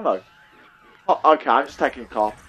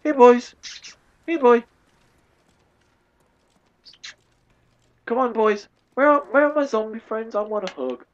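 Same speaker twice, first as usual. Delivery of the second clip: high-pitched sing-out to pets; mock-pleading high voice; playful coaxing call; 7 semitones higher than usual. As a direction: call them over in a high, silly coo. Coaxing missing zombies like pets.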